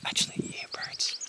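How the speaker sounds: soft whisper so birds stay; hushed, breathy whisper. Real, not acted. Pointing out birds in tree.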